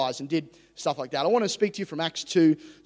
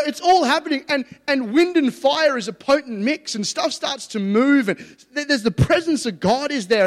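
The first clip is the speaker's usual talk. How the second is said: loud, higher, building sermon; fired-up preaching; 7 semitones higher, 8 dB louder than usual. Acted. Preacher at full force describing Pentecost to the church. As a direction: preach it with rising fire.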